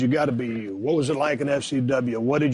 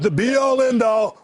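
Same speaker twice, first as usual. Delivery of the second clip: higher, louder hyped-up shout; excited shout; 8 semitones higher, 3 dB louder than usual. Real, not acted. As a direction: sell it like the ultimate prize. Co-host hypes the dream job.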